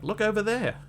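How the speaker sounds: mock native caller pointing at the animal; pointing and calling out. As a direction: call it out as if pointing across the bay. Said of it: "they were pointing and saying".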